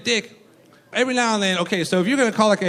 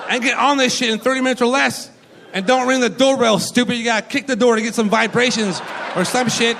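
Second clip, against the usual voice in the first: exasperated rant; 4 semitones higher than usual. Retold or imitated. Operator imagines shouting at the pizza guy, exasperated. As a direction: plead the caller's case, worked up. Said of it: "I can't be like".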